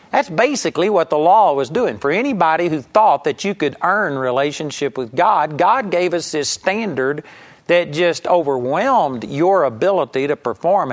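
ordinary talk

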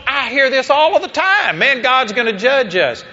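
lightly voiced objector, pitched high; imitated objectors. Acting out objecting listeners.

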